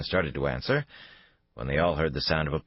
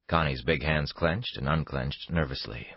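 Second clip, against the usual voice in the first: plain reading voice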